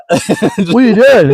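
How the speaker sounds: high, slow, alarmed; alarmed question. Retold or imitated. Telling a friend about the eye doctor, slow alarmed question. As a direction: ask it like he can't believe it.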